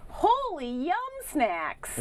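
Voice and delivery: high, slow cartoon character voice; slow squeaky cartoon catchphrase; Pam Poovey voice. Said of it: "Say it how you would say it in her character voice".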